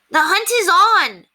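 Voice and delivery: high, loud, slow cry; wild cry. Acted. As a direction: cry it out like a hunting call. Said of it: "the incorrigibles cried".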